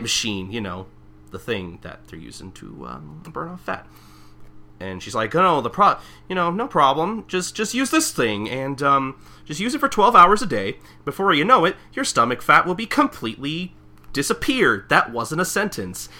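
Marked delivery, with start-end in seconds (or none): 5.3-14.9 perky saleswoman. Retold or imitated. Voicing perky Marilyn pitching.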